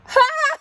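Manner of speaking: high burst of laughter; a sudden loud cackle. Real, not acted. Laughing at her own post, a loud high burst.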